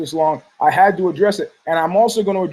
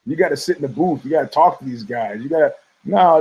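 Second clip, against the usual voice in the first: ordinary talk, nothing marked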